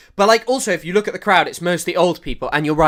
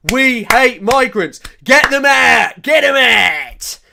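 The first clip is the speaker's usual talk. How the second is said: slow, loud, higher mock chant; hammed-up crowd of old bigots chanting; 6 semitones higher, 6 dB louder, slower than usual. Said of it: "really racist old people just like".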